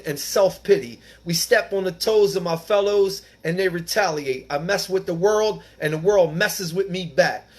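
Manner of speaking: reading aloud. Acted. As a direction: read the text out with weight to the group.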